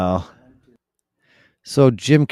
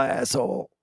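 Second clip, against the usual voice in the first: ordinary delivery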